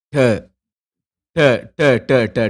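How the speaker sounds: ட said as a crisp retroflex "ta", repeated slowly; Tamil: ட என்று தெளிவாக உச்சரி; Sinhala ட sound. Shown as a demonstration. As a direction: drill ட clearly for the class. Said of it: "உச்சரிங்க".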